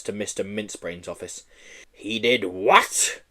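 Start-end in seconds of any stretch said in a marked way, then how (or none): 1.9-3.2 bellowed. Acted. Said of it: "bellowed an outraged Mister Mincebrains".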